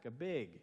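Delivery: imitated Minnesota "big" for bag; Minnesota pronunciation. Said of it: "You guys say big".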